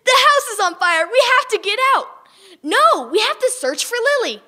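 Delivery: high, loud urgent yells; panicked shouting. Acted. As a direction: shout over the flames. Family panics in fire.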